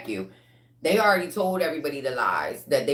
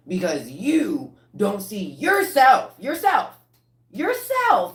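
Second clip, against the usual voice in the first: each yourself higher and louder; building emphasis; 7 semitones higher, 6 dB louder, slower than usual. Real, not acted. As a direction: climb higher on every yourself. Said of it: "repeats with rising voice".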